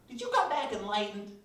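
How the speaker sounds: high, loud mock enthusiasm; hammed-up excited question; imagined admirer. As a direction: gush it as an eager questioner. Voicing an imagined admiring listener.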